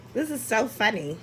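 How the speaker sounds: ordinary talk, nothing marked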